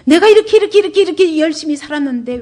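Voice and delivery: loud, high, insistent grumbling; mock complaint. Preacher voices believers protesting their hard work, complaining. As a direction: pile up the repeats in an acted protest. Mimics congregation's complaint to God.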